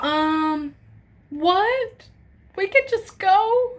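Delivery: mock stunned disbelief. Told they can simply leave.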